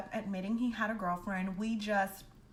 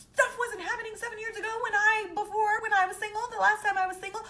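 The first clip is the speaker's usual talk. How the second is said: exasperated complaint; 11 semitones higher, 6 dB louder, faster than usual. Retold or imitated. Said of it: "I'm like".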